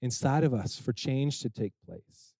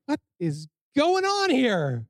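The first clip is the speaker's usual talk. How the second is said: a higher, slow, lightly voiced puzzled governor; stern governor; 9 semitones higher, slower than usual. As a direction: play Nehemiah baffled at the weeping. Nehemiah shocked at crying people.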